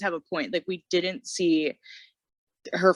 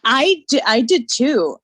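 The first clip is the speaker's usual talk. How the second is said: excited agreement; 8 semitones higher, 7 dB louder, slower than usual. Real, not acted. Eager agreement about Jocelyn.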